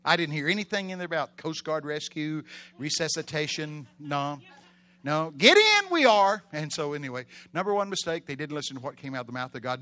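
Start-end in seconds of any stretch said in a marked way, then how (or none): 5.3-6.4 high, loud order; shouted command. Acted. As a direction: bellow it as an absolute promise. Repeats Jesus' order forcefully.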